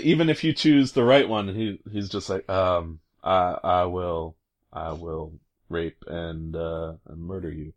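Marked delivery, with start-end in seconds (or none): 3.2-7.7 worst delivery. Retold or imitated. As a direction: mumble it out like a terrible actor. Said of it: "he just does the worst delivery".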